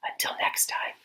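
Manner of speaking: soft unvoiced whisper to the end; whispered sign-off. Host signs off his quiet edition to viewers, whispered. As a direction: tiptoe out of the show in a whisper.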